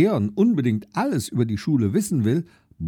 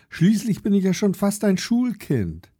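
put-on little boy; 8 semitones higher than usual. Leon voiced in story.

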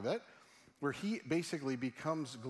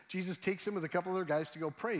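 ordinary delivery

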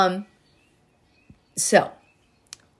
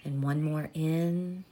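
soft spa whisper; 2 semitones lower, 8 dB quieter than usual. Acted. Leads a body breathing meditation.

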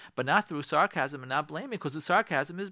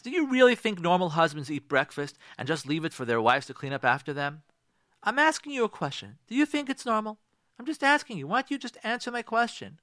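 pressing for an answer while really blaming; question criticism; 2 semitones higher than usual. Shown as a demonstration. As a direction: badger with accusing questions. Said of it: "question criticism".

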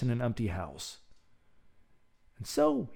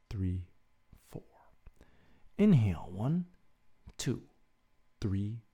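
guided breathing; 3 semitones lower, 5 dB quieter than usual. Acted. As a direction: count the breaths calmly and gently. Leads a breathing exercise.

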